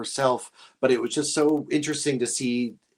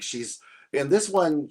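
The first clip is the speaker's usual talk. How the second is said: normal talking voice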